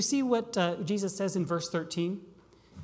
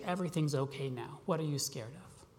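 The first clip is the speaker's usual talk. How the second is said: much softer, lower, gentle; tender reassurance; 5 semitones lower, 7 dB quieter than usual. Pretend. Father holding scared child, soft and tender. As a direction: soothe a frightened child.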